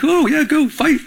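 high, playful cheer breaking into laughter; laughing mock encouragement; amused egging-on. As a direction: cheer them on, then crack up. Amused cheering on his defenders.

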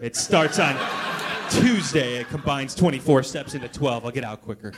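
low, mumbled excuse of a drinker; mumbling customer reply. Retold or imitated. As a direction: grumble it like a guy dodging the bar.

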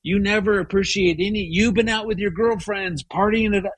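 high, fast, accusing outburst; hammed-up hurt husband lashing out; mock accusing rant, pitched well up and quick; imagined angry retort. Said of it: "got into a whole thing with her".

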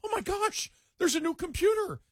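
high-pitched, gushing excitement; thrilled. Retold or imitated. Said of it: "I was thrilled".

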